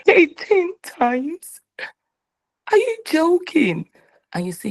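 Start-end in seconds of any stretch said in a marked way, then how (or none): 0.1-1.6 very high, slow "Eighteen times?" in disbelief; shocked disbelief. Acted. Soul shocked at the assignment. 2.7-3.9 shocked disbelief. Acted. Soul shocked at the assignment.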